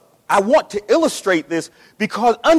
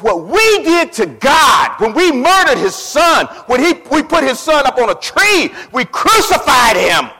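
preaching emphasis; 3 semitones higher, 5 dB louder than usual. Real, not acted. Sermon climax on crucifixion.

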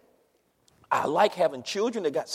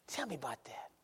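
whispered, much softer, barely voiced; faint whisper; 2 semitones lower, 17 dB quieter than usual. Dying brother begs the young preacher for scripture, faint whisper. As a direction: breathe it like a dying man's request.

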